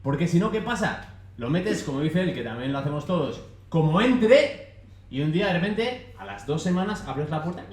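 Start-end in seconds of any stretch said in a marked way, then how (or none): 3.7-4.8 drawn out, high and loud; careless motto. Retold or imitated. Speaker echoes a pupil's fridge-packing method, drawn out. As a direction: stretch it out emphatically, ending on a rise.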